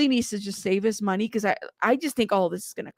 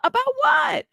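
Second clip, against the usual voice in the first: shocked outburst. Real, not acted. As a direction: burst out in disbelief. Shocked by chat comment.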